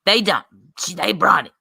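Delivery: hammed-up New York accent, punched opening. Streamer reading out a rival's post, mock New York accent. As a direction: put on a rough attempt at New York. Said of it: "this New York accent".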